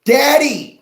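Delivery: put-on high, loud little kid calling Daddy; child's voice. Kids at home crying out to Daddy, high and loud. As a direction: squeal it like a small child.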